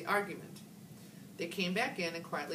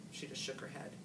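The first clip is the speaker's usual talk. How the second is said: hushed; 4 semitones lower, 11 dB quieter than usual. A narrator's reading. Narrator goes hushed.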